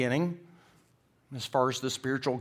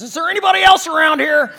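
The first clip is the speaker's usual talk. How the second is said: very high, full-volume comic holler; scared yell; 17 dB louder than usual. Acted. Tourist rejecting the advice and calling for someone else, scared yell. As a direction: shout past the voice for other help. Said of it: "he's scared".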